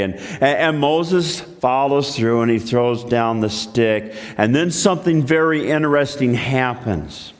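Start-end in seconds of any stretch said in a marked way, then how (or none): none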